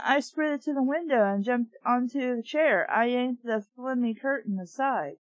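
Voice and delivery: ordinary delivery, nothing marked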